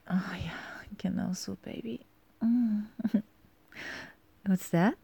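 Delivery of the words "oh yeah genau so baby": soft pleased whisper. Acted. To her partner during intimacy: soft whisper. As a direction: breathe it out with pleasure.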